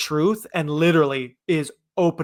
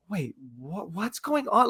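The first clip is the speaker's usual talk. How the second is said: retold confusion; 6 dB quieter, slower than usual. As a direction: act out dawning bewilderment. Acts out confusion as a kid.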